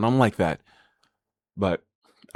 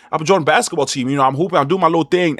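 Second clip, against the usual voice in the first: normal talking voice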